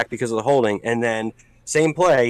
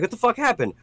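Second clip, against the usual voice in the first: baffled; 8 semitones higher, 4 dB quieter than usual. Retold or imitated. Retells confusion at dropped points.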